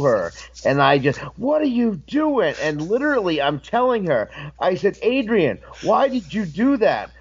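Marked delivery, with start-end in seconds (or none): 1.4-2.5 high-pitched retold outrage; going off on her. Wrestler confronting the DJ after the show, mock indignant. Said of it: "just going off on her". 5.0-7.1 higher-pitched acted scolding; going off on her. Wrestler getting in the DJ's face, acted scolding. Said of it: "just going off on her".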